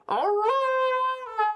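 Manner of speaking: high wolfish call; howling call. Acted. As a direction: howl it out like the pack. GM voices the pack calling.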